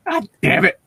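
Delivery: breaking into a silly falsetto curse; mock high voice. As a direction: still squeaky and high, laughing it off. Keeps high put-on voice.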